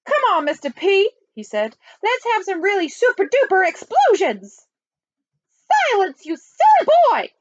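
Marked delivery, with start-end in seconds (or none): 0.1-1.1 high, eager general's voice; excitable army chief. Acted. 2.0-4.7 high, eager general's voice; excitable army chief. Acted. 5.9-7.3 high, loud reprimand; scolding nanny. A narrator's reading. Nanny scolds the army chief.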